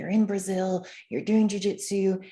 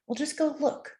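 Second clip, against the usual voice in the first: higher, lightly voiced wise grandfather; a hint of an old man urging kindly; gentle grandfather advice; 6 semitones higher than usual. Host voicing his grandfather urging him to go, lightly voiced. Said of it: "he was really the one who said".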